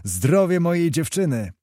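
a rousing toast called to the room; shouted toast. Acted. Kmicic toasts his sweetheart to his friends, shouting. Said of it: "zakrzyknął".

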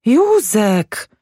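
high-pitched wife calling her husband; reproving wife. A narrator's reading. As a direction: call him to order in a high woman's voice. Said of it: "mówiła żona".

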